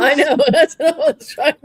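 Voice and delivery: talking through laughter, high and loud; laughing. Real, not acted. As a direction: burst out laughing while protesting. Both crack up over the pronunciation.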